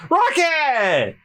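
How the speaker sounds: high, shouted move name; high battle cry; attack call. Acted. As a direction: yell it as you launch. Luffy launching attack.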